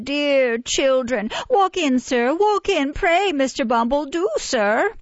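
high, eager fawning welcome; fawning nurse. A narrator's reading. Nurse fawning over the beadle at the gate, high and eager. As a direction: gush over the visitor at the gate.

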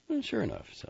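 high, hollow wife's voice; lightly voiced hurt wife; Alma, resigned. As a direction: say it tight and bitter. Alma's bitter reply.

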